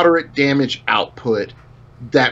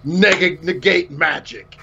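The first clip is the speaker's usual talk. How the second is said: mustache twirling villain; slower than usual. Pretend. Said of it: "somebody that's just like".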